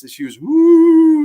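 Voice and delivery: high, loud, slow ghost wail; ghostly woo. Retold or imitated. Mimicking a cartoon ghost lady, high, loud and drawn out. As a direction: draw out an eerie ooh.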